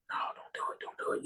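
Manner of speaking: hushed inner voice, barely breathed; whisper. Retold or imitated. His inner voice whispering him out of asking, whispered. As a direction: whisper it like a voice in your head talking you out of it.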